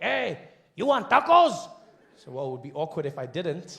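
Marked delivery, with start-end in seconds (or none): 0.0-1.7 put-on higher, slow Mexican-accented taco seller; taco vendor greeting at the window. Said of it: "He's like".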